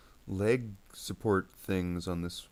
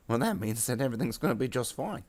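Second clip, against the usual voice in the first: high little grot voice; grot minion; 5 semitones higher, 4 dB louder than usual. Acted. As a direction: chirp it as the boss's grot. Grot lies to its boss.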